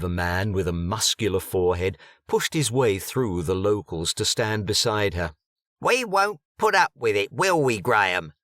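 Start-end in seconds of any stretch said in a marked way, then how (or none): 5.8-8.3 brash, louder East London woman; East London accent. A narrator's reading. Bianca confronts Egbert at the bar in brash East London accent. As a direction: push it, cocky East London.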